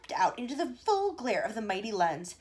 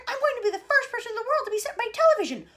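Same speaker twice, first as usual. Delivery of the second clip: high, loud shout; 9 dB louder than usual. A narrator's reading. Mike shouting as he runs to the camera. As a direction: shout it while running. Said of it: "he shouted as he ran".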